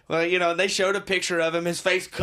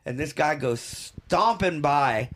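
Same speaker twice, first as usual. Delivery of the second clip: ordinary talk, nothing marked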